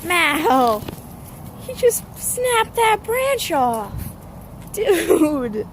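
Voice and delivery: excited call. Real, not acted. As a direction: call him out as the branch snaps. Calling out, dog snaps branch.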